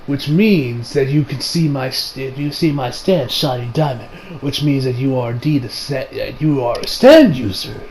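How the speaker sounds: quiet gotcha tone; low, knowing reveal. Retold or imitated. Josuke springs his trap.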